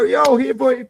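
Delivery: high, loud friendly dog call. Shown as a demonstration. Calling a charging Cane Corso over in a high, loud friendly voice.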